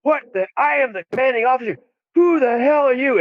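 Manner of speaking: high, indignant officer shouting in surprise; startled officer. Retold or imitated. Woken commander demanding who the raiders are, high and indignant. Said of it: "He goes".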